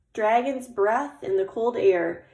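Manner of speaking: plain reading voice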